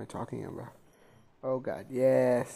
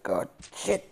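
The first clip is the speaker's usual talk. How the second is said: usual speaking voice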